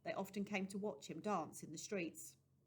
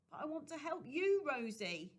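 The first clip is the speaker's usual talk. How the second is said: higher and louder, calling after her; 7 semitones higher, 4 dB louder than usual. Acted. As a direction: call out to someone leaving. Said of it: "Jim called out".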